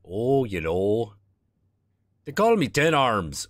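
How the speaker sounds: cheerful Midwestern doctor, slow; loose, easy Midwestern drawl; Midwestern accent. Acted. Voices Ambrose.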